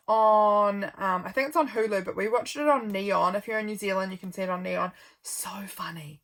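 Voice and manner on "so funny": breathy whispered aside; whispering. Real, not acted. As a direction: whisper it to yourself. Whispered aside about a show.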